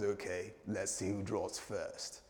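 low, soft, slow cowboy menace; gunslinger showdown. Acted. Gunman to his rival before the draw, low slow challenge. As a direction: draw it out quietly before the shot. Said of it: "And he says".

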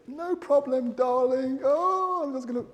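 high, syrupy mock angel voice; mock angelic spouse. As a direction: parody a saintly sweet spouse. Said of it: "You guys are like angels".

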